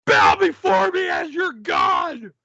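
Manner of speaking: mock triumphant shout. Gloating after winning round.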